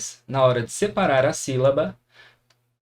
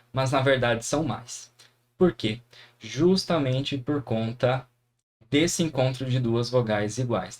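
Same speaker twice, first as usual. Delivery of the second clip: ordinary talk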